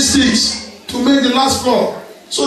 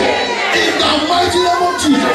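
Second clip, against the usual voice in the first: preaching at full force; 7 semitones higher than usual. Real, not acted. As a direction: build to a fervent shout.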